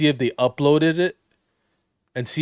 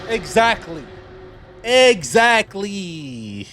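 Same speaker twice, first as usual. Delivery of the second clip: high, loud exclamation, stretched further on repeat; emphatic agreement; 9 semitones higher, 7 dB louder, much slower than usual. Real, not acted. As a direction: crow it, drawing out the last one. Crows over the quoted retort.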